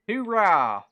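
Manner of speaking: mock patriotic cheer. Telling a viewer he is American, with a hammed-up loud cheer. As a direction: play up being all-American, tongue in cheek.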